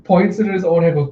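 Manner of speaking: ordinary delivery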